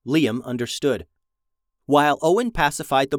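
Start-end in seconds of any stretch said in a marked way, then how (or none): none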